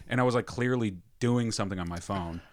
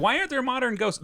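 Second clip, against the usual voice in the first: much higher, whiny mocking of people; mocking quote; 13 semitones higher, faster than usual. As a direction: sneer at the naive question. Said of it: "people are like".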